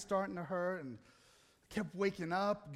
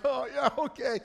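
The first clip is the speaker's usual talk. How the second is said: higher, louder sarcastic agreement; mock agreement; 5 semitones higher, 5 dB louder than usual. Husband answering wife who calls him a baby, sarcastic. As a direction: play along with exaggerated agreement.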